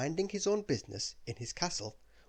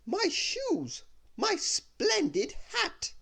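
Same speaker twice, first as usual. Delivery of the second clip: much higher, slow prissy wail; fussy lady lament; 4 dB louder, much slower than usual. Acted. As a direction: drag out the fuss over the hat. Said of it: "she said".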